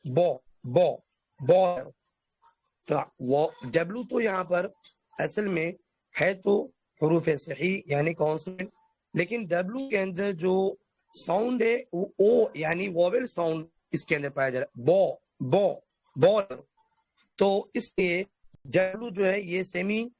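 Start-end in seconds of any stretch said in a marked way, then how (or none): 0.0-1.9 teacher isolates the بو sound, lengthened and gentle; stretched vowel demo. Shown as a demonstration. Teacher breaks بالر into بو بو for students, drawn out. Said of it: "آواز کھنچ رہی ہے اور نرمی". 14.8-16.6 teacher isolates the بو sound, lengthened and gentle; stretched vowel demo. Shown as a demonstration. Teacher breaks بالر into بو بو for students, drawn out. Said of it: "آواز کھنچ رہی ہے اور نرمی".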